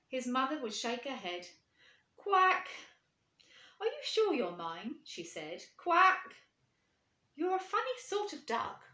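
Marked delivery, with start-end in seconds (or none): 2.2-4.9 high, loud mother duck voice; a doubting mother duck. Acted. 5.8-8.8 high, loud mother duck voice; a doubting mother duck. Acted.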